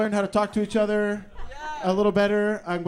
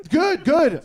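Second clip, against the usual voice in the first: acted cheerful reply; 8 semitones higher, 7 dB louder than usual. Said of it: "back in the grocery store".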